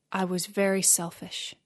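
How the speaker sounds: soft, subdued self-reproach; said to himself. A narrator's reading. Giant realising his selfishness, softly talking to himself. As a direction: quietly admit it to yourself. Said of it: "The giant said to himself".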